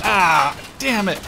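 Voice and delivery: loud angry curse; frustrated yell. Real, not acted. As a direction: yell at the screen. Dies in game.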